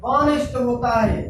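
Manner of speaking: ऑनेस्ट said "onest" with no h sound; silent h. Shown as a demonstration. Teacher showing students the silent h, carefully pronounced. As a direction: drop the h in ऑनेस्ट, start on the vowel. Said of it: "उच्चारण".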